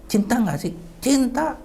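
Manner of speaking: high-pitched insistent demand; retold snapping. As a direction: imitate pressing a grieving parent for an answer. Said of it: "saya sentak". (menyentak dengan suara tinggi)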